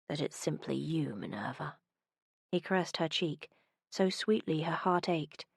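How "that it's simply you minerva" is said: husky, dropping to almost nothing on the name. A narrator's reading. Man confesses to Minerva, husky and fading. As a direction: go husky and sink away on Minerva. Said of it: "His voice went husky".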